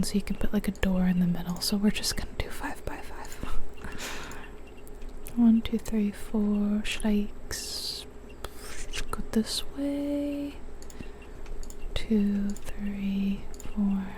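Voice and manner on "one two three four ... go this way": ordinary talk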